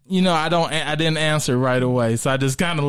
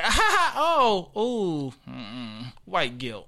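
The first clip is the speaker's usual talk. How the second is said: imitated audience reaction; 3 semitones higher, slower than usual. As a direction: mimic a crowd's laughter turning uneasy. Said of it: "and they like".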